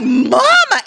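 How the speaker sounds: high, loud mock reproach; scandalized. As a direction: exclaim it at mama, aghast. Retells scolding her mother.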